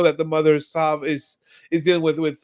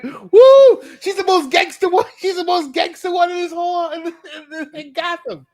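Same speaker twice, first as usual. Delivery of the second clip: excited whoop; 13 semitones higher, 8 dB louder than usual. Retold or imitated. Own excited reaction retold.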